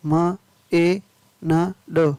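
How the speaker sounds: slow, separate phonics sounds; word broken slowly into its sounds; sounding out. Shown as a demonstration. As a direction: say each sound on its own. Phonics teacher sounding out spelling.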